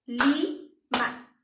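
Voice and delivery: Lima split as li-ma, a clap on each syllable; clapped syllables. Shown as a demonstration. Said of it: "También tiene dos sílabas". (Lima silabeada con palmadas)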